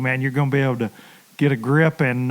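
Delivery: usual speaking voice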